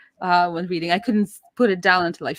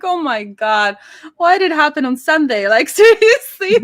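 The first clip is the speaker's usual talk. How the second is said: rising loud, higher, laughing disbelief; exasperated disbelief; 6 semitones higher, 9 dB louder than usual. Retold or imitated. Told her mom didn't want to be there either, loud laughing disbelief. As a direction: throw up your hands, half laughing.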